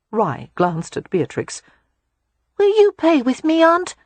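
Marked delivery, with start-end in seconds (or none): 2.6-3.9 put-on high child voice, asking; small boy begging a playmate; eager little boy. A narrator's reading. Boy asks aunt to play.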